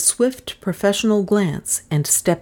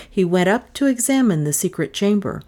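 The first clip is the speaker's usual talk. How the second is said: plain reading voice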